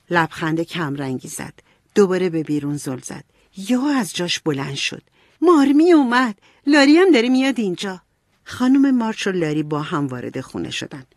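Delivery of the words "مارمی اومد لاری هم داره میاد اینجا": high, loud calling out; excited announcement. A narrator's reading. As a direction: call the news out eagerly, raised voice. (با هیجان، بلند و زیر خبر دادن)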